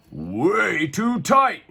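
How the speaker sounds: high, slow, strained king's voice; drawn-out complaint of a tight crown; King Pablo voice. A narrator's reading. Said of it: "he said".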